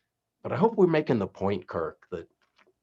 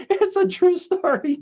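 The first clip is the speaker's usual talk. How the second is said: loud, very high, cracking up; real laughter; 7 dB louder than usual. Real, not acted. Joker insisting again to his co-host, helplessly laughing. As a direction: squeal it out through the laughter.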